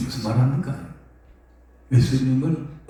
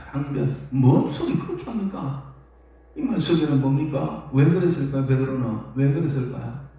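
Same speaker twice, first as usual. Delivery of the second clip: ordinary talk, nothing marked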